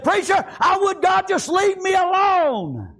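high, slow, shouted protest; shouted defiant quote. Acted. Preacher voices a sinner shouting to be left alone. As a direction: bellow it like a man wanting God gone.

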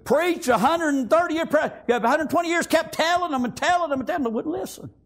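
quick, high, driving sermon; fast fervent preaching. Acted. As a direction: press the point home, fast. Preaching at full force.

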